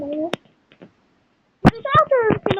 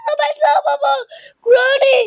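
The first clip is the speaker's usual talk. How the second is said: high, loud panicked cry; frightened screaming; 9 semitones higher, 5 dB louder than usual. Real, not acted. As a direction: scream it out while fleeing. Caught by Granny, screaming.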